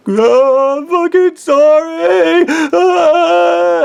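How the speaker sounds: high, loud wailing apology; hammed-up hysterical bawl; acted traumatized crying. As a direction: cry out in mock agony, dragging the words. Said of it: "crying, and he's".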